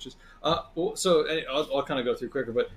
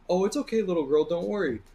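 higher, gentle reassurance; reassuring border agent; 7 semitones higher than usual. Retold or imitated. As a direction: soothe a scared little girl. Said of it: "looks at her and goes".

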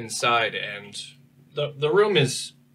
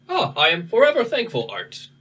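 high, loud, grateful robot voice; 9 semitones higher, 5 dB louder than usual. Acted. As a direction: thank them in a bright robot voice. Robot NPC thanks the player.

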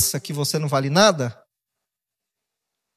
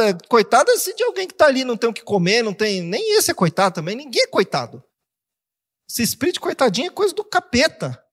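emphatic rebuttal; 5 semitones higher than usual. Retold or imitated. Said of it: "Eu falo".

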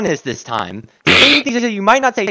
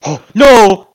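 loud dismay; 4 semitones higher, 8 dB louder than usual. Real, not acted. Root beer about to be thrown.

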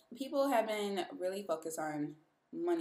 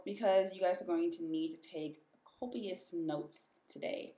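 ordinary talk

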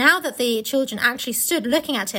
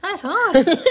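laughing; 5 semitones higher, 5 dB louder than usual. Real, not acted. Laughing at tongue twister.

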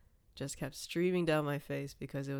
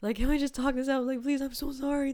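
imitating tearful pleading, higher and louder; retold pleading; 9 semitones higher, 6 dB louder than usual. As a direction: beg through the door, re-enacted. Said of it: "I was like".

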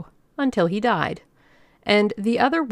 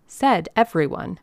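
ordinary delivery

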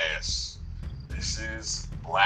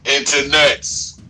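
loud, slow announcer call; drawn-out call; 6 semitones higher, 11 dB louder, slower than usual. Acted. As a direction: stretch it out like a host. Show opening call.

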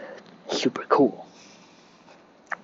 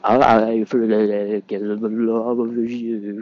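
mock shivering; 3 semitones higher, 8 dB louder, much slower than usual. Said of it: "the whole podcast just being like".

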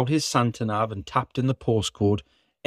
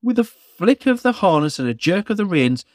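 plain reading voice

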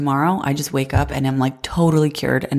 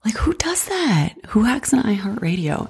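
incredulous whisper; 6 semitones higher than usual. Real, not acted. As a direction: whisper it in disbelief. Baffled aside about hackers.

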